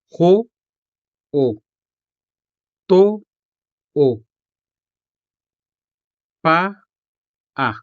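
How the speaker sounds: Ho-o, to-o, pa-a each split and lifted high; broken into syllables. Shown as a demonstration. Teacher breaking words down syllabically, high and separated. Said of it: "let's break these words down syllabically".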